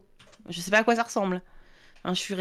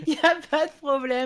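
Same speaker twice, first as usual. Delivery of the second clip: laughing; 6 semitones higher than usual. Real, not acted. Laughing at partner's yawn.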